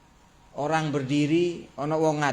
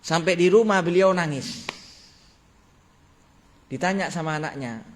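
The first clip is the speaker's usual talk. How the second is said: normal talking voice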